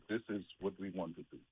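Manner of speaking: ordinary talk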